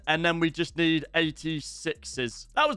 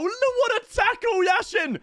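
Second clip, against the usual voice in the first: much higher, delighted yell at the tackle; excited cheer. Real, not acted. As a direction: cheer the save like a fan. Reacting to a great tackle.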